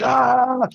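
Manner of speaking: sudden high yelp right in the ear; a drunk guy freaking out with a yelp; startled yell. Retold or imitated. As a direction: jolt out a startled "Ah!". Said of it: "He's like".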